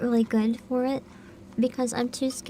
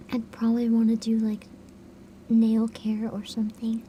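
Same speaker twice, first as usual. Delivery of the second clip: whisper; 2 semitones lower than usual. Shown as a demonstration. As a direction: keep it hushed like ASMR. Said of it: "ASMR whisper continued".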